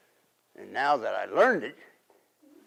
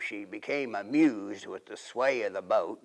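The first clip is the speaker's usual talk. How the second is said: usual speaking voice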